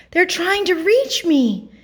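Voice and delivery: high, drawn-out wonder; marveling. A narrator's reading. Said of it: "the moon marveled".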